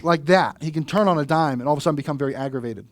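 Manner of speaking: ordinary talk, nothing marked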